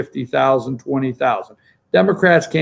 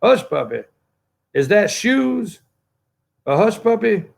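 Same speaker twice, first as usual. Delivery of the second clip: mock clueless candidate; 6 semitones higher than usual.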